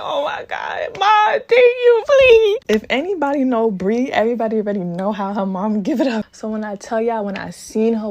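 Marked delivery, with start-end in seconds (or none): 0.0-2.6 high, slow crying plea; much higher, louder sobbing thanks; mock sobbing relief. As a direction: cry out thanks to the rescuer. Rescued, crying out to mom.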